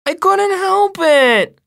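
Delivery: put-on high, drawn-out little-boy whine; whining. A narrator's reading. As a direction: whine loudly like a small kid defending himself. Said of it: "kinda whining".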